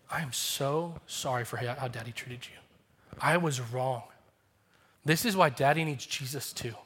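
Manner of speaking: kneel down and say sorry gently; tender apology. Retold or imitated. Father on his knees apologizing to his young son, hushed and tender.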